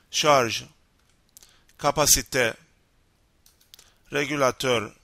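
ordinary talk, nothing marked

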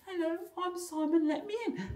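very high little pretend voice; small squeaky gadget voice; talking gadget. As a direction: play a tiny key begging entry. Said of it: "and it says".

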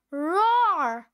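loud roaring creature voice; big angry monster roar. Acted. Monster roars back.

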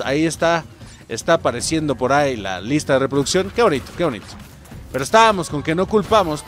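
usual speaking voice

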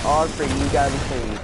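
normal talking voice

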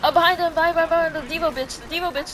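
fast, high, loud warning shout; urgent callout. Real, not acted. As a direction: yell it fast so the team turns. Calling out enemy to teammate.

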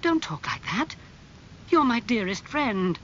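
high-pitched, pained pleading; acted dismay, voice lifted; unhappy. A narrator's reading. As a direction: implore a dear friend. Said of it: "said Basil unhappily".

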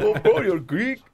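high-pitched mock-Greek delight; badly faked, excited Greek accent. Greek local greeting a fellow Greek, badly faked accent. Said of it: "can't even do a Greek accent".

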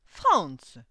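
sudden loud shout of the name; loud exclamation. A narrator's reading. Old clockmaker opens the door to the visitor, loud cry. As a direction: cry out the name, falling at the end. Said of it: "s'écria Coppelius".

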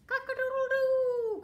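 loud, very high crow, long last note; crowing rooster. Acted. Reader plays the rooster for children, loud crowing. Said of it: "crowed the rooster".

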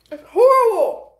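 pained cry through the sourness; loud, high groan at the taste; eating sour candy. Real, not acted.

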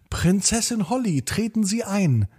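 bow and welcome the princess; servant greeting. Acted. Servant welcoming Holly at the door, high and soft. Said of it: "sagte er".

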